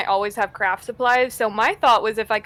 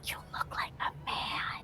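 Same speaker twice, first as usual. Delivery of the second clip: barely-there whisper; hushed in-character aside; whispered jab; 17 dB quieter than usual. Acted. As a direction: deliver the insult under your breath. Confiding insult in character.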